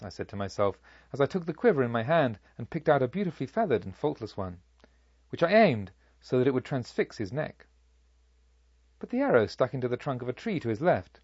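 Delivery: usual speaking voice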